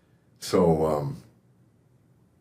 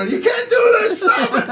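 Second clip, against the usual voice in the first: put-on high, loud yell at misbehaving kids; angry shout; 6 dB louder than usual. Dad scolding his sons on the golf course, angry shout. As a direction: scold them sharply, top of the voice.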